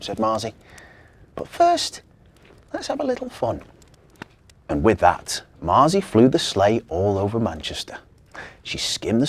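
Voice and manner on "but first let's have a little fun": high, mischievous invitation; playful elf. Acted. Marzi suggests a joyride before home, high playful. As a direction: tease them with a treat.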